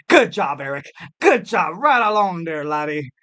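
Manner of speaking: put-on Scottish accent. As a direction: congratulate in a mock Scottish accent. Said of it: "I went to a Scottish accent".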